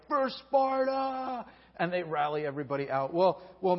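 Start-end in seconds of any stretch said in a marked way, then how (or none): 0.1-1.5 high, slow rallying war cry; drawn-out general's battle cry, higher pitched. Retold or imitated. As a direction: call the troops out like a Spartan general. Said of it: "the general of the battle is like".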